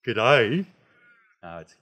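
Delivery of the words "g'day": tense, loud questioning greeting; like having a test. Shown as a demonstration. Teacher mimicking a nervous learner greeting a local, tense and questioning. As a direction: push it out loudly, unsure, rising at the end. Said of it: "like you're having a test".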